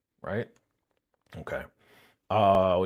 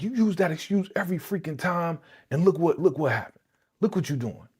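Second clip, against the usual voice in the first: raised-pitch mock scolding; imitated telling-off; 7 semitones higher than usual. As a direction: lay into him as the fed-up wife. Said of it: "Sonya was going in on this boy".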